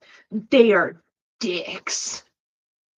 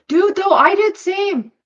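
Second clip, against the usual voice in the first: excited; 10 semitones higher, 8 dB louder than usual. Real, not acted. Excited agreement.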